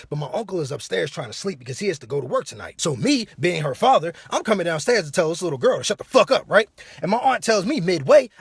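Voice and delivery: ordinary delivery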